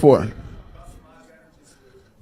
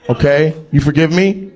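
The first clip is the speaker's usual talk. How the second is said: usual speaking voice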